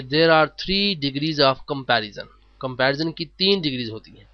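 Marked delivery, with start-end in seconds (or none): 0.0-2.3 slow dictation. Shown as a demonstration. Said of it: "slow teacher read".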